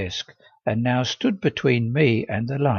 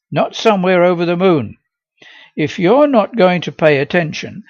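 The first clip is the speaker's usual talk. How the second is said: a strict schoolmistress scolding; stern teacher; 7 semitones higher, 9 dB louder than usual. Acted. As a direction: lecture the daydreaming pupil firmly.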